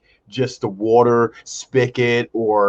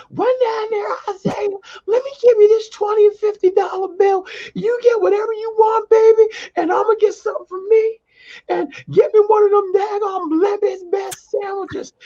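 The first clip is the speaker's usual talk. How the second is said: put-on very high, crying old grandma voice; hammed-up teary granny sending him out; grandma falsetto. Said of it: "She would like".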